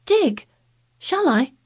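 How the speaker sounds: high, loud startled question; astonished. Acted. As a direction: gasp it out in disbelief. Colin astonished.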